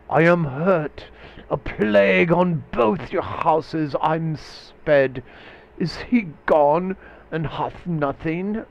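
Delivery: loud, high theatrical cry of a stabbed man; hammed-up dying curse; dying Mercutio. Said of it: "now he's dying".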